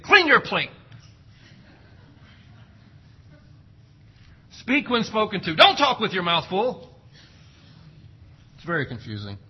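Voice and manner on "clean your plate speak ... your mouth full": higher, louder nagging commands; mock parent orders. Preacher mimics parent nagging kids at the dinner table. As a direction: rattle off orders like a nagging mom.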